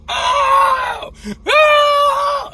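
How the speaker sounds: high, loud pained wail, reliving the moment; pained cry. Retold or imitated. Man on the ground calls out as his ankle pops, high pained wail. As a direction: cry out like the ankle just popped. Retelling ankle pop, crying out.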